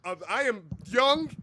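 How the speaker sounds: loud, high, hammed-up protest; mock-indignant shouting at a fan; mock outburst. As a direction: yell it in comic indignation. Joking outburst denying being a father.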